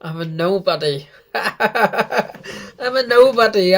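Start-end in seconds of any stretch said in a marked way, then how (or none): 1.3-3.8 slow, laughing through the words. Real, not acted. Fan laughing at himself while repeating the line. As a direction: chuckle it out, amused at yourself.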